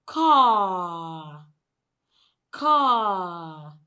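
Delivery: "car" ending on a long falling "ah"; long vowel. Shown as a demonstration. Teacher models first word for repeating, long vowel. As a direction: draw out "car" without an r. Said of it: "the first word is".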